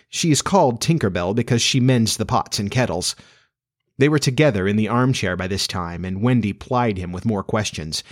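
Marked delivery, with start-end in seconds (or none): none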